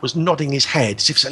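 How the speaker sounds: ordinary talk, nothing marked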